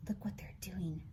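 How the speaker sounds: hushed whisper; soft secretive whisper. Acted. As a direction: whisper as if not to disturb them. Hushed reaction while watching the characters.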